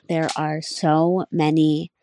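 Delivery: normal talking voice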